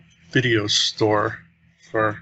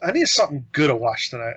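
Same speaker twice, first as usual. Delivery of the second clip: bossy customer; 3 semitones higher than usual. Retold or imitated. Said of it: "she go".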